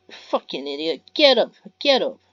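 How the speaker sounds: loud, high, cursing bark; furious yell. Acted. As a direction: scream at him to get up. Mia yelling at fallen Ben.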